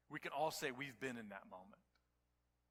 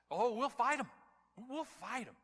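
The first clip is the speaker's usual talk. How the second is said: defiant crowd; 10 semitones higher, 9 dB louder than usual. Retold or imitated.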